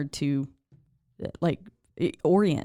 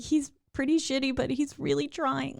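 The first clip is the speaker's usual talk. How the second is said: pleading reaction; 8 semitones higher than usual. Retold or imitated. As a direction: beg the movie to spare him. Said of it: "I was like".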